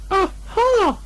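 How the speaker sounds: very high, thin piglet aha; a brave try that falls short; weak squeaky aha; feeble aha. Acted. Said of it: "it wasn't a very good aha".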